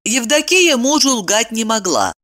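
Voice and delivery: ordinary delivery, nothing marked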